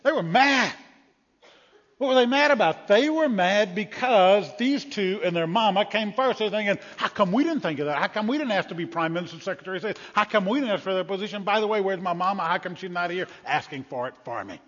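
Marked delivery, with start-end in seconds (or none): 0.0-6.4 loud, high, indignant preaching; preaching the disciples' outrage; emphatic shout. Real, not acted. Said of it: "Greek word for indignant means". 7.0-14.6 raised, whiny complaining; the ten disciples grumbling; jealous disciples. Acted.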